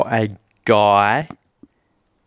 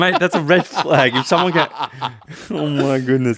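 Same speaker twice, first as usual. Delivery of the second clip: laughing through the words, higher pitched; 6 semitones higher, 3 dB louder than usual. Real, not acted. Friend laughs and protests the host's example. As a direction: giggle while you object. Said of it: "laughing at the joke".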